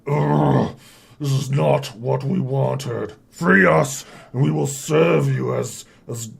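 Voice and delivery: loud, forceful chained orc; orc warrior. Acted. Chained orcs demand freedom from nobles, loud orc voice. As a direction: growl out a loud, proud demand.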